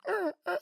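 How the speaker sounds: very high little dog whimper; cowed whimper. Retold or imitated. Pit bull to its scolding owner, cowed whimper. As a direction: squeak like a dog backing down fast. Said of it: "he bitched up real quick".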